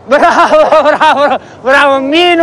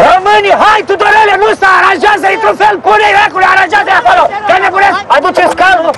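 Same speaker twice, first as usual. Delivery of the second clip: high-pitched shouting; shouted orders; 4 semitones higher than usual. Acted. Director to extras and crew, shouting orders. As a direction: yell commands across the film set.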